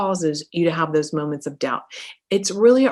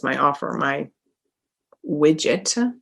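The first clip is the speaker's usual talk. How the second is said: ordinary delivery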